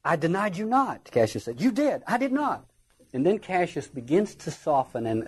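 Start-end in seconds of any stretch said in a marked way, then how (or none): 0.0-1.1 high, emphatic rebuttal; indignant denial. Acted. Cassius answering Brutus's charge, indignant. Acting out the quarrel. 1.6-2.7 high, quick-fire contradiction; bickering back and forth. Acted. Brutus and Cassius quarrelling, indignant.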